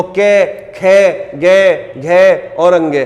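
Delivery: के, खे, गे, घे, अंगे drawn out slowly from the throat; pronunciation demo. Shown as a demonstration. Teacher demonstrating throat sounds के खे गे घे to students, slow. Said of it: "उच्चारण करके देखोगे".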